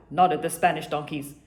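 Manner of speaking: ordinary delivery, nothing marked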